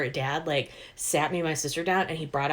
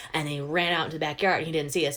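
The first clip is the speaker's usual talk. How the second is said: usual speaking voice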